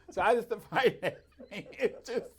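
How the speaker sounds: high, loud laughter breaking through words; laughing. Real, not acted. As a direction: laugh it out at the mix-up. Laughing at his own story.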